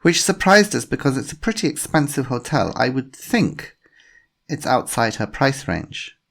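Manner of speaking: put-on a polite inspector with a faint German accent; slight German accent. A narrator's reading. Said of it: "a woman with a slight German accent".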